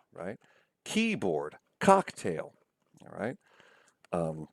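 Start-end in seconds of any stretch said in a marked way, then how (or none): 0.8-2.5 KEYBOARD and COCKTAIL hit on KEY and COCK; strong first syllable, weak second in KEYBOARD, COCKTAIL; trochaic stress. Shown as a demonstration. Lecturer giving trochee examples, stress on first syllables. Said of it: "accent falls on the first syllable".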